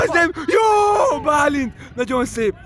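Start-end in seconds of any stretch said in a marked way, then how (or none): 0.0-1.2 drawn-out high shout. Real, not acted. Live game, teammate fails. 1.2-1.7 shout. Real, not acted. Calling out to teammate.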